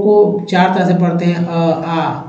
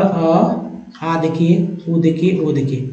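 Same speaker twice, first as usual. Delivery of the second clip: slow drawn vowels; 3 dB quieter, much slower than usual. Shown as a demonstration. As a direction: stretch each vowel out slowly. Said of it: "आंख बंद करके". (हर स्वर को बहुत धीरे खींचकर)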